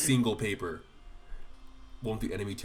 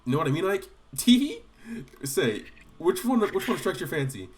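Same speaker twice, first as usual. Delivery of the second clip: leering nudge; 9 semitones higher, 7 dB louder than usual. Pretend. Gatrie teasing Ike about girls in a loud leer.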